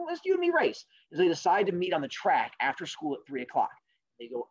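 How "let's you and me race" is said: high turtle voice. Acted. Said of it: "And the turtle goes".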